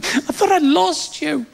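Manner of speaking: high, drawn-out tearful wail; imitation of a girlfriend in tears; mock sobbing. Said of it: "she was sobbing".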